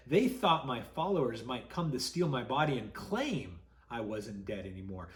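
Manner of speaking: usual speaking voice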